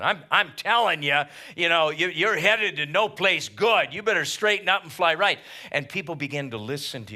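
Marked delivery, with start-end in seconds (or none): 0.0-5.4 stern loud warning. Acted. Preacher voices warning to a sinner.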